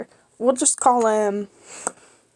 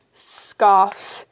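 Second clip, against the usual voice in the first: ordinary delivery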